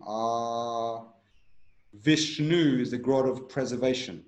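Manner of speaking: ordinary talk, nothing marked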